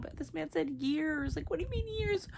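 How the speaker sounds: upset protest. Real, not acted. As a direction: push back in disbelief. Upset at news in book.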